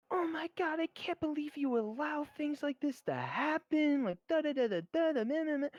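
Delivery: put-on high whiny complaining girl; mocking blah blah. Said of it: "she's like".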